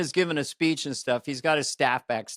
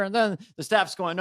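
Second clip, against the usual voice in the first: normal talking voice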